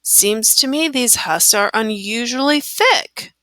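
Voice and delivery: ordinary delivery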